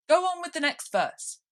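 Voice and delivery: high, loud impatient Gryphon. Acted. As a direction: snap it out again, hurry. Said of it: "repeated impatiently".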